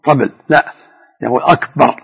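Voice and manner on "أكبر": "أكبر" with the ba drawn out, pitched higher; wrong stretched akbar. Shown as a demonstration. Scholar warning against stretching akbar. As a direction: demonstrate the mistaken long "أكبر". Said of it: "لا يمدها".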